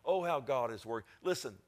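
normal talking voice

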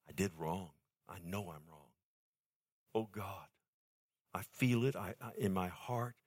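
soft, low, broken-off admission of guilt; hushed confession. Acted. Believer confessing sin to God, hushed and low. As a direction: murmur it to God as if ashamed. Voicing a repentant believer's prayer.

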